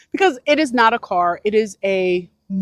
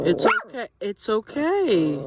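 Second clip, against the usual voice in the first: soothing; 4 dB quieter, slower than usual. Real, not acted. Soothing the dogs.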